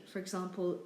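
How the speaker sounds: normal talking voice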